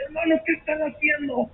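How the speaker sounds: a mother shouting a warning to the church; mother's warning. Retold or imitated. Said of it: "Y mi mamá dice".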